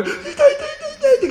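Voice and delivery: put-on high, whining child's cry of pain; whiny hurt kid. A hurt boy whines to his mother. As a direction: whimper like a beaten little boy.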